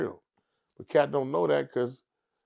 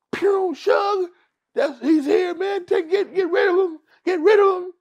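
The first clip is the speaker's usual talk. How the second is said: much higher, louder frantic pleading; panicked high-pitched Cat; 8 dB louder than usual. Retold or imitated. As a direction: beg Suge in a frantic squeak. Cat begging Suge to act.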